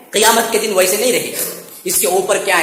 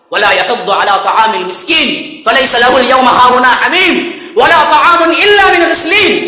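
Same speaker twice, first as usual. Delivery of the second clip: chanted recitation, melodic and raised; Quranic recitation; 3 semitones higher, 3 dB louder than usual. Acted. Preacher reciting the verse to the congregation, chanted. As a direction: intone the verse with tajweed.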